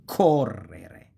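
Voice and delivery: correre slowly, cor stretched and punched; stress on third-to-last. Shown as a demonstration. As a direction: hit COR, let re-re fall away. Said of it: "falls on the third to last syllable".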